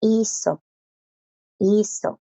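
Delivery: Iso said clearly with the s linked to the o; model word. Shown as a demonstration. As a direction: link the s into the o in Iso. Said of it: "eso es la O".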